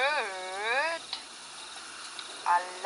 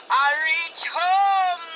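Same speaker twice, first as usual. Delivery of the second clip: deep masculine ghost voice; slower than usual. A narrator's reading. Ghost rider thanking Mister Kwashi for the ride, eerie and slow. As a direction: thank the man for the ride, then gloat. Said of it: "followed by a deep masculine voice".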